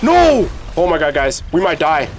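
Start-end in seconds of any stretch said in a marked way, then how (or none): 0.0-0.5 alarmed no. Real, not acted. About to lose fight.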